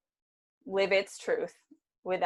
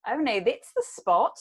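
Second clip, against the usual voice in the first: put-on Australian accent; 4 semitones higher, 4 dB louder, slower than usual. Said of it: "I just couldn't stop doing the accent".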